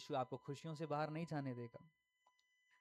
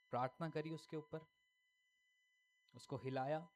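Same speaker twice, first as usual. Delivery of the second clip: ordinary talk, nothing marked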